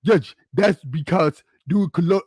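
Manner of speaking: put-on a light try at a Nigerian woman's accent; mock Nigerian accent, soon abandoned; imitating her Nigerian accent. Reading her message aloud in a half-hearted Nigerian accent. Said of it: "I should do it in her voice".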